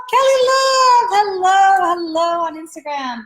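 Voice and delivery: high, loud squealed hello, easing off; excited greeting. Real, not acted. Host spots Kelly Love on Instagram mid-explanation, excited greeting. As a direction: interrupt yourself with a delighted call. Calls out to arriving viewer.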